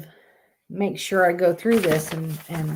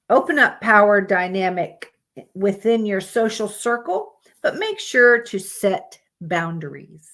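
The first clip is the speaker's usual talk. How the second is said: ordinary talk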